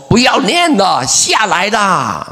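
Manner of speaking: high-pitched scolding, last word stretched out; retold angry yell. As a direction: play an exasperated wife calling her husband down. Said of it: "他太太非常的生气". (模仿太太生气地喊)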